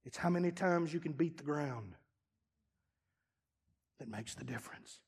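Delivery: very soft, low; hushed close. Acted. Preacher closing the sermon, very soft. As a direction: let it fall to almost nothing.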